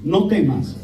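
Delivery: scripture reading. Shown as a demonstration. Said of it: "Vamos a repetirlo".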